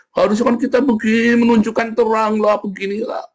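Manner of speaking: hammed-up sanctimonious whining; mock whiny moralizer.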